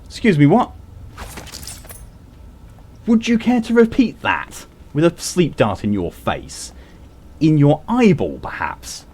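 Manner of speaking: high, indignant, posh outrage; mock offended. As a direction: play it huffy and threatening. Mock offense at game character.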